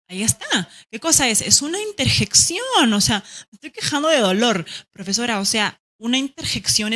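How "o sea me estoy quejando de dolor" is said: ordinary talk, nothing marked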